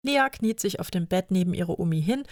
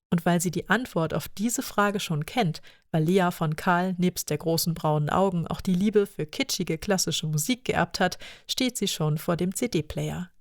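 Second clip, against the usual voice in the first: usual speaking voice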